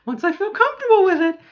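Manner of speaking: high, loud laughing start. Real, not acted. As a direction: laugh through the words.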